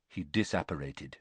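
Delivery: ordinary talk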